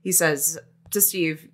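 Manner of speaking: ordinary talk, nothing marked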